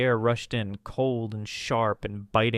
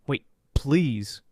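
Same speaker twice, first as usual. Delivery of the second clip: choked plea; 2 semitones higher, 3 dB louder than usual. A narrator's reading. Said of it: "he choked, voice small and raw".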